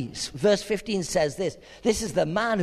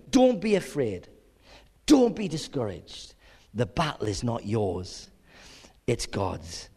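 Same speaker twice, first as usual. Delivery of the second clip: fading lower and softer to a hush; gentle, calming reassurance that trails away to a hush; softly reassuring; 11 semitones lower, 3 dB quieter than usual. Acted. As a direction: comfort them, dropping almost to a whisper.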